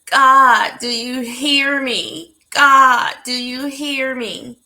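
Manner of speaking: a pleading shout upward, slowing; calling out to God in tears, the name loud; desperate cry. Retold or imitated. Crying out to God.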